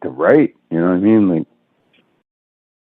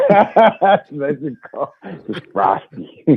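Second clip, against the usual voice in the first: drawn out, laughing echo; amused echo; 8 semitones higher, much slower than usual. Real, not acted. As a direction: stretch it out slowly through laughter. Amused echo of the other speaker's joke.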